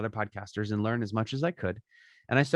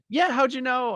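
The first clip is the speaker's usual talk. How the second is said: higher-pitched imitation of a surprised woman; delighted surprise; 12 semitones higher, 3 dB louder than usual. Podcast host recognised by a stranger, surprised. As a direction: act out her startled, pleased reply.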